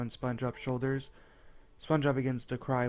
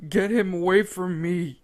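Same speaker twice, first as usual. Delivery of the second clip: higher, louder panicked plea; terrified yell; 6 semitones higher, 8 dB louder than usual. A narrator's reading. Beaten Squidward yelling in fear to Sandy and Patrick. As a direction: beg in panic to be saved. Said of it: "trembling with fear, yelled".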